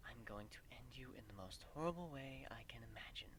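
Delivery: hushed threat out of the corner of the mouth; whisper. Acted. Celestia smiling at the crowd, whispering a threat to the host. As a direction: whisper a sweet death threat through a smile. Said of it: "whispers out of the corner of her mouth".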